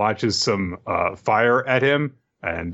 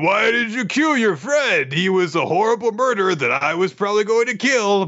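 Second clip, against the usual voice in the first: upset high voice; 10 semitones higher than usual. Retold or imitated. Dora upset.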